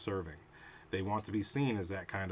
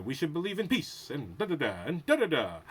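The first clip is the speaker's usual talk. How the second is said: higher, louder grandstanding hero voice; mock dramatic speech; 6 semitones higher, 5 dB louder than usual. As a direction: ham up a pompous hero's promise. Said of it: "he has a dramatic speech".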